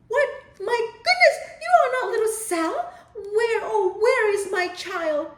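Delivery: high, loud, panicked cry; gasping fright. Acted. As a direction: find a bear instead of your child. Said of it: "turned around and gasped".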